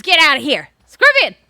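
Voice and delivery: startled yell at a scorpion; shout at scorpion. Real, not acted. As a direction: shoo it off with a loud yell. Yelling at scorpion in game.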